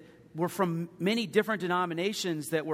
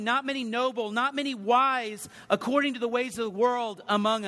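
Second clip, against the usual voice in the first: raised, higher preacher's voice driving each point; full-force preaching; 7 semitones higher, 6 dB louder than usual. Acted. Pastor preaching to his congregation on Paul, raised and forceful. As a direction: preach it out to the pews with fire.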